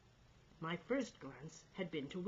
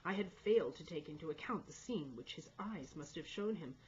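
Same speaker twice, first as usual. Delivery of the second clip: ordinary delivery, nothing marked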